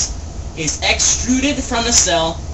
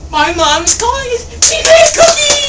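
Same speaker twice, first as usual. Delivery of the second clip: high shouted glee about cookies; excited shout; 7 dB louder than usual. Acted. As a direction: squeal it out, thrilled.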